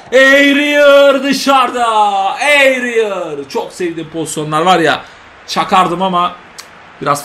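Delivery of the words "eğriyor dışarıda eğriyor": "Eğriyor" drawn out, high and loud; long shouted "Eğriyor" as the shot bends; commentator shout. Acted. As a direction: stretch out "Eğriyor" in a big yell. Commentator calling a curler.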